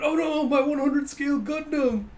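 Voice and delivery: high, loud mock panic; freaking out. Captain seeing his model kit wrecked, hammed-up panic. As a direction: wail for a ruined treasure. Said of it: "Narumi freaking out".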